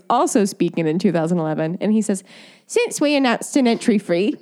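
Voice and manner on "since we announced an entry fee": quoted spokesman in a put-on English accent, pitched up; theatrical English official, laughing into the words. Reading the committee spokesman's statement in a put-on English accent. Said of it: "say in the accent".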